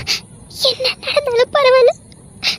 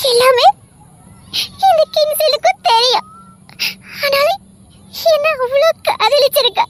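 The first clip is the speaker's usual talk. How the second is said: moved to tears; 5 semitones higher than usual. Acted. Husband realizes wife's love.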